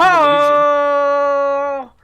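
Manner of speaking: loud lookout call from a mast; shouting from crow's nest. Acted. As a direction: bellow it out across the water.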